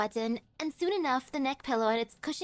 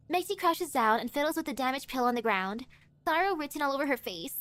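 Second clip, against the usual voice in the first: ordinary delivery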